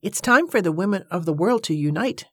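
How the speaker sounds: ordinary delivery, nothing marked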